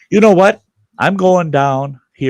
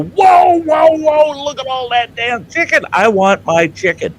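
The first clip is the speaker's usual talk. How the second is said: high loud whoa, whoa, whoa; outraged whoa; 10 semitones higher, 4 dB louder than usual. Retold or imitated. Customer spotting tray of chicken, high loud outrage. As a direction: shout it up high at the sight.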